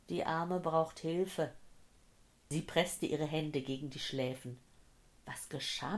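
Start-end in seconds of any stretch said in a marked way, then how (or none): 0.1-1.5 slow, subdued repetition; slow echo. Acted. Dazed woman recalling the old man's cry, slow and subdued. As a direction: let the remembered words drift past slowly. Earlier cry echoes in her head.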